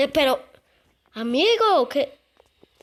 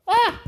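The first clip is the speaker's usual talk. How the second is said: startled yell; 8 semitones higher, 6 dB louder than usual. Real, not acted.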